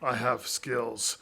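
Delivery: low, slow action hero growl; slow, low, deadly serious threat; Liam Neeson impression. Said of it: "I was picturing Liam Neeson".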